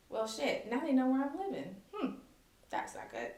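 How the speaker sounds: soft, dropping to near whisper; quiet dawning worry. Retold or imitated. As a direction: say it under your breath, uneasy.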